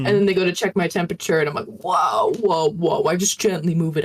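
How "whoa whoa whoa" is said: easygoing Southern whoa; mild put-on Southern protest; fake Southern accent. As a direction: gently fend them off, lightly Southern. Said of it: "doing this, like, fake Southern accent".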